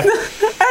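squealing laugh; high burst of laughter. Real, not acted. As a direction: lose it completely. Breaks up laughing.

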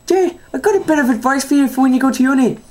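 squeaky tipsy aunt giving advice; high drunk aunt voice. Retold or imitated. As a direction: pitch it up like a drunk auntie.

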